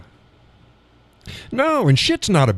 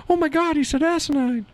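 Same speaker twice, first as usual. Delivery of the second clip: very high, squeaky pearl-clutcher voice; mock scandalised. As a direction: imitate a horrified prude way up high. Mimics someone shocked at the word.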